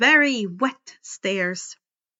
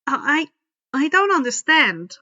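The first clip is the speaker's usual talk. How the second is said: nervous stammer; 4 semitones higher, 5 dB louder than usual. A narrator's reading. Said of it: "I said slowly".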